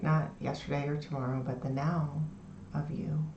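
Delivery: soft, low, soothing meditation voice; guided meditation. Shown as a demonstration. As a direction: ease them into the body with long pauses. Leads a lying-down meditation.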